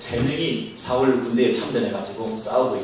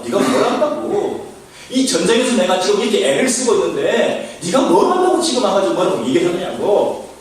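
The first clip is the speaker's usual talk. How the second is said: mock indignant older brother; 5 semitones higher, 4 dB louder than usual. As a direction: scoff and rant like an irritated elder brother. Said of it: "거슬리는 거예요". (화가 난 큰형 흉내, 높고 크게)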